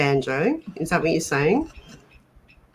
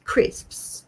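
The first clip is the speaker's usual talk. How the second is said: ordinary delivery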